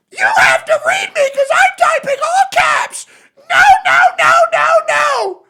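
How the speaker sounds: very loud screeching imitation of an all-caps chatter; mock tantrum scream. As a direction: scream it like a bratty baby, mocking them. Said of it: "scream really loud".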